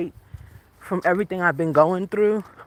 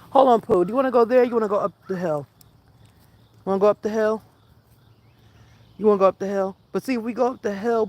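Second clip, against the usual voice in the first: talking to dog; 4 semitones higher, faster than usual. Real, not acted. Talking to her dog on walk.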